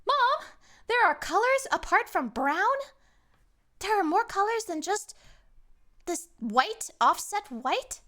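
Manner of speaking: put-on high, loud child's voice; distressed child. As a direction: plead like a baffled little child. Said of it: "That child is gonna go outside".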